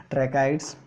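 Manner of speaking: ट्रैकाइड्स said with a chuckle; laugh, then say ट्रैकाइड्स; amused ट्रैकाइड्स after a laugh. Shown as a demonstration. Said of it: "आप जो बोलना चाहो बोल सकते हो".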